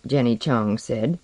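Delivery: ordinary delivery, nothing marked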